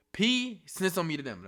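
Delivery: loud, high-pitched complaint; outraged exclamation. Real, not acted. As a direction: hammer home the betrayal loudly. Repeats outraged accusation.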